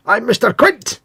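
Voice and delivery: high, hammed-up fake Scottish; bad Scottish accent. Host mocking an American actor's role, bad Scottish accent. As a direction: botch a Scottish accent with gusto. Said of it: "American actor trying to be Scottish".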